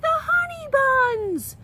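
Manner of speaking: gasped cry. Acted. Stanley seeing bees on the buns, high gasped cry. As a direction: gasp out the discovery. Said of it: "Then he gasped".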